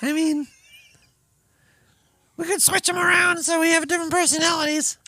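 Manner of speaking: high squeaky kobold chatter. Acted. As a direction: keep up the tiny kobold squeak. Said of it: "I got stuck in kobold voice".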